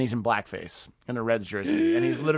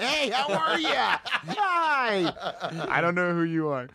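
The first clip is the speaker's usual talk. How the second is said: phony nice guy; 8 semitones higher, much slower than usual. Pretend. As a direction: fake friendly Hollywood greeting, high and slow. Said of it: "Hollywood phony nice guy".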